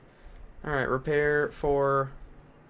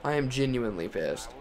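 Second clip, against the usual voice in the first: ordinary delivery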